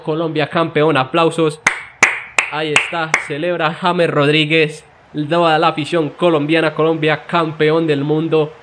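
excited commentary. Acted. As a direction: celebrate the final whistle like a commentator losing it. Mock commentator celebrates final whistle.

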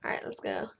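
low unvoiced murmur; whisper. Real, not acted. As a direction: whisper it to yourself. Whispered resolve.

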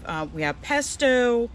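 ordinary delivery

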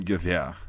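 Gewehr as guh-VEH-ah, W as V; actual German pronunciation. Shown as a demonstration. Teacher giving the actual pronunciation, Gewehr with V. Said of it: "It's actually pronounced".

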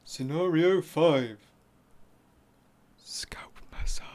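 slow whispered title; ASMR whisper. Acted. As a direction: breathe the title softly.

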